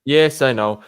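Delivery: ordinary delivery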